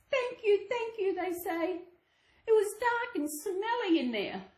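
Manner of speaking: high, relieved thanks; grateful girl. Acted. Girl and Granny thanking the woodcutter, high and relieved. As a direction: gush with relief.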